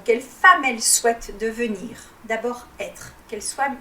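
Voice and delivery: usual speaking voice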